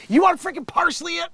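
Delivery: loud, high indignant yell; mock outrage. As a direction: yell back like an outraged customer. Acted outraged customer answering chef.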